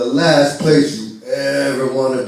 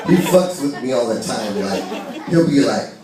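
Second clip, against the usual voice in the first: usual speaking voice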